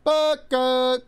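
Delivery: high, loud overdone call; yelling Booker. Pretend. Friend mimics parent yelling for a lost kid. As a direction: holler the name as if lost in a crowd. Mock calling kids' names.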